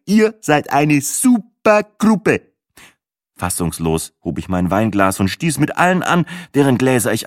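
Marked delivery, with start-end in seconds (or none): 0.1-2.5 high, loud, drawn-out praise; big, high-pitched compliment, stretched at the end; gushing announcement. Acted. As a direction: address the whole group with loud enthusiasm. Acted speech to the group.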